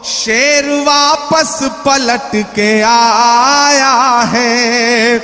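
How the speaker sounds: loud, sung Urdu verse recital; stately nazm recitation. Acted. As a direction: chant each verse slowly with swelling force.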